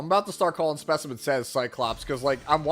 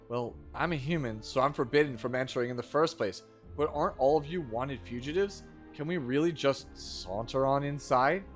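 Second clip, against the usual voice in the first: bad acting; 5 semitones lower, 6 dB quieter than usual. Reads game dialogue aloud.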